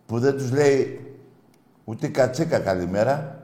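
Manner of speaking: usual speaking voice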